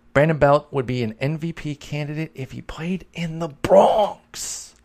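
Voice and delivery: put-on playful New York accent; put-on Bronx accent. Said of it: "why I'm giving that sort of accent".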